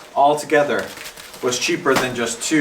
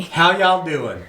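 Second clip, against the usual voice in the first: slow, higher Southern drawl; Southern accent; 5 semitones higher, slower than usual. Shown as a demonstration. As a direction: model a drawn-out Southern greeting. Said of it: "say some phrases in Southern".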